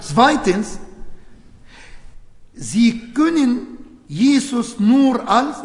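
normal talking voice

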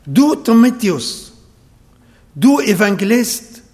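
ordinary talk

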